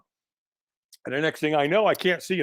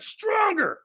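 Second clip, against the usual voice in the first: very high, straining yelled command; screamed demand. Acted. Trainer screaming in walker's face, demanding more. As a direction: scream it high as a mock drill order. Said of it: "he screamed at me".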